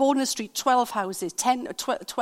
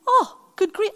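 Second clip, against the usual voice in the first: good grief; 9 semitones higher, 5 dB louder than usual. Retold or imitated. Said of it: "I'm sitting there thinking".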